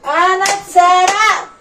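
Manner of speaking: a high, loud, slow cry of "I was robbed"; mock wail. Influencer begging viewers, hammed-up loud slow wail.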